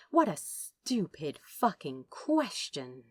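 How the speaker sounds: usual speaking voice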